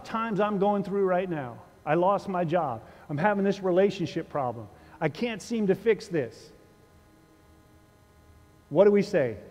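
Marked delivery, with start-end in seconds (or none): none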